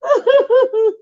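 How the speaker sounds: high, squealing laugh near tears; a helpless high shriek of laughter; laughing fit. Real, not acted. To her viewers, squealing with laughter.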